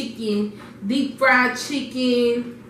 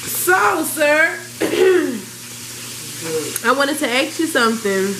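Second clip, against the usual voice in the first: mock formal; 5 semitones higher, 3 dB louder than usual. Formal greeting again.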